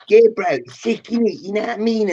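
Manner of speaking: lightly mocked Croydon street accent; mock South London slang. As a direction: parody the nephew's slang. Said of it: "it's all like".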